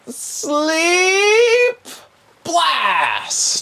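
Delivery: very high, drawn-out shouted incantation; spell-casting cry. Acted. Game master's sorcerer casts sleep at player, high shout. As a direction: hurl the spell with a loud, stretched cry. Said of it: "And he goes".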